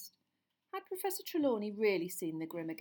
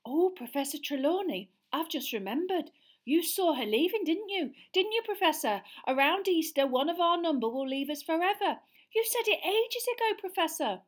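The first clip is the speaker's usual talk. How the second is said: put-on high, louder, gushing girl; excited schoolgirl; 9 semitones higher, 8 dB louder than usual. A narrator's reading. Pupil eagerly flattering her teacher. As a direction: gush eagerly. Lavender voice.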